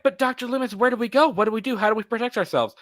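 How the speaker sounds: high pleading voice; mock pleading woman.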